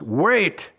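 ordinary delivery

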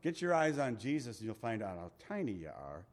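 ordinary delivery

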